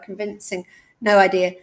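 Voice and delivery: usual speaking voice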